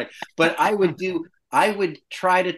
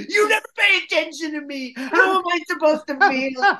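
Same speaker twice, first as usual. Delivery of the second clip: higher, louder whiny complaint; mock screaming fight; 5 dB louder than usual. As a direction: throw hurt accusations back. Other actor's side of the staged fight.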